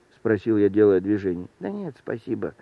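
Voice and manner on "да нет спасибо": soft, mildly dejected refusal; glum. A narrator's reading. Little man turning down offered help, glum. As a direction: decline quietly and dolefully. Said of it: "сказал человечек уныло".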